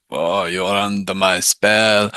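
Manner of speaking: slow, looming vampire hypnotist; domineering Nosferatu. Shown as a demonstration. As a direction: cast a spell on them slowly. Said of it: "kind of Nosferatu".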